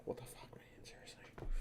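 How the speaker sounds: mock exasperated whisper; whispered disbelief. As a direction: breathe it out in disbelief. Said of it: "I'm literally like".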